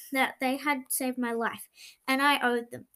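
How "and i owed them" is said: usual speaking voice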